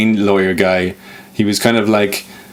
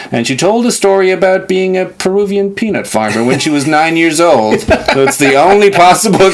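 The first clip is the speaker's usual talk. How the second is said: bad American accent; 3 semitones higher than usual. TV doctor explaining the twist to stumped colleagues, smug bad American accent. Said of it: "he had that terrible American accent".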